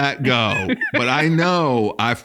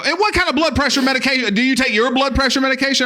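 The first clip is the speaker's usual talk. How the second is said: high, fast, louder worried quiz; mock nagging relative; 11 semitones higher, 3 dB louder, faster than usual. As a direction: pester like family. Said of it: "my own family go".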